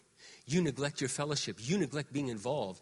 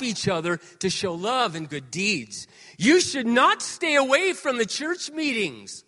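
preached verse; 7 semitones higher, 9 dB louder, slower than usual. Acted. Reading verse at full force.

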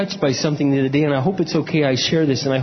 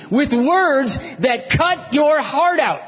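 high, slow, shouted emphasis; impassioned preaching; 9 semitones higher, much slower than usual. Real, not acted. Preacher describing hurtful words in marriage, impassioned. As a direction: cry it out, stretching the key words. Preaching at full force resumes.

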